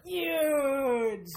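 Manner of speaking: big parody boast, vowel stretched; Trump. Pretend. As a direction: punch out a hammed-up politician's catchphrase. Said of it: "other than just huge".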